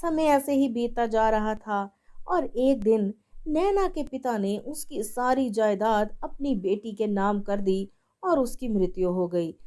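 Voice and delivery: ordinary delivery, nothing marked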